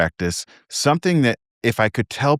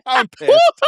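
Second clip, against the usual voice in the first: very high, loud giggly squeal; angry while laughing; 6 dB louder than usual. Shown as a demonstration. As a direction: squeal mock anger through laughter. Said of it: "say I was angry and laugh".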